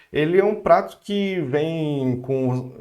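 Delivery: ordinary talk, nothing marked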